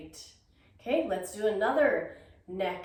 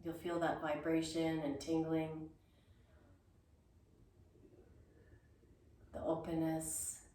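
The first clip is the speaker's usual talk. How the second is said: soft guided meditation; 2 semitones lower, 9 dB quieter than usual. Acted. Guided meditation close of exercise.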